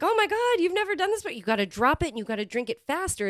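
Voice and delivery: high, eager bartender voice; excited bartender. Retold or imitated. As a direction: gush at her like the bartender did. Bartender voiced in retold story.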